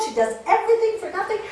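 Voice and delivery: fast, high, loud indignation; indignant defense. Retold or imitated. Student defending Debbie, fast and indignant. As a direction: defend her friend heatedly.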